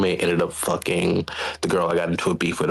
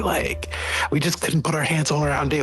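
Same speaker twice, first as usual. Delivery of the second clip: high-pitched retold group confession; imitated confiding friends; 8 semitones higher than usual. Voicing the friends' awkward confession.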